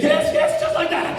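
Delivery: very high, loud, excited approval; delighted director. Retold or imitated. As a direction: squeal your approval as the thrilled director. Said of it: "And he said".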